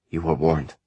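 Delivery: level, unmoved statement; flatly. Acted. Ishrak dismissing the bruised servant, flat. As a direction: say it without a flicker of feeling. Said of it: "she said flatly".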